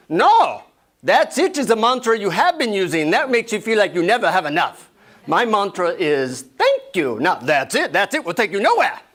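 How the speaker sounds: put-on high-pitched scolding Indian-accented guru. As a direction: correct the pupil firmly in an Indian accent. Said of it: "deep Indian accent".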